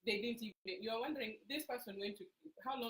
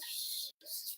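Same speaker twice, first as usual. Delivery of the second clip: song's hush; 4 dB quieter than usual. Shown as a demonstration. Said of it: "And then it goes".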